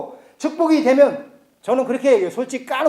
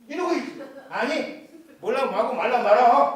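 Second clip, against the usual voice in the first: usual speaking voice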